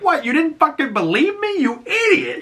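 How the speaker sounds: very high, incredulous scold; mock-furious at an idiot; exasperated scolding. Harcourt on the phone scolding Peacemaker, very high and exasperated. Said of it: "and she's like".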